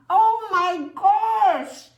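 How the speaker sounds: drawn-out, high, loud gasp of surprise; slow, wide-eyed oh my gosh; big surprise. Real, not acted. Surprise at story event.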